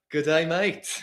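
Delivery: lightly attempted Australian accent; a hint of put-on Australian. Shown as a demonstration. Performing for the host on request, light Australian accent. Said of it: "do an Australian accent for us".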